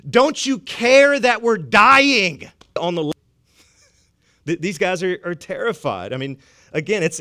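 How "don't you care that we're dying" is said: loud, slow, higher-pitched accusing cry; desperate plea. Acted. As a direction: plead as if about to drown. Disciples' desperate question to Jesus.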